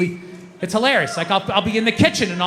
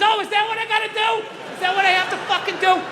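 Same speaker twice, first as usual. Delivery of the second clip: higher, hammed-up yell at the TV; mock angry yell; 10 semitones higher than usual. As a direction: bark sarcastically at a dumb TV. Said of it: "Don't scream like that".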